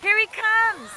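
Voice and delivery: excited announcement. Real, not acted. Excitedly spotting someone coming downhill.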